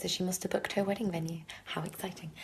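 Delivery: hushed excited aside; whispering. Real, not acted. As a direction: whisper it to yourself. Aside about friend's wedding news.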